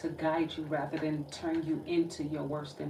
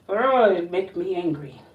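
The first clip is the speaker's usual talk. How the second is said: loud angry outburst; real anger; 10 dB louder than usual. Real, not acted. Reader admits the energy makes her angry, loud and real. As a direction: let the anger burst out loud. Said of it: "I'm still getting angry".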